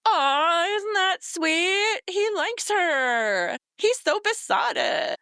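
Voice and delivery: high, slow cooing; gushing characters. Retold or imitated. Characters gushing over a twelve-year-old's suitor, high slow coo. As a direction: coo over it like it's adorable. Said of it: "all the other characters are like".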